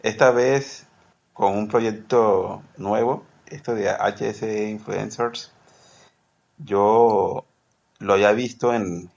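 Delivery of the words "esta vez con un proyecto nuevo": ordinary talk, nothing marked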